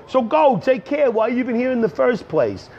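high-pitched comic dismissal; mock-indignant send-off; exasperated retort. Retorts to viewers who are leaving.

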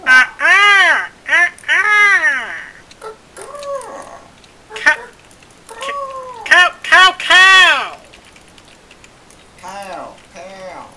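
high, loud sing-song calls; playful calling. Real, not acted. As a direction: chant it out like a game.

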